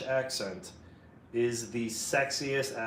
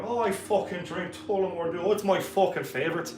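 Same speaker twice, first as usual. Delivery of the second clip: higher, rowdy Irish brogue; Irish accent; 6 semitones higher than usual. Acted. Streamer answering people at the bar about whiskey, lively Irish accent. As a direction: boast like an Irish drinker at the bar.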